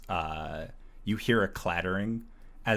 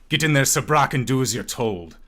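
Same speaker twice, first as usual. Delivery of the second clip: loud, higher stern command; older man; 7 semitones higher, 11 dB louder, faster than usual. Acted. Older man ordering the boy inside, loud and stern. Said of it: "a older male voice just".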